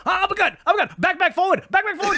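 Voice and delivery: high, quick mock fight coaching. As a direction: call out moves like a backseat gamer. Mimics daughter coaching fight.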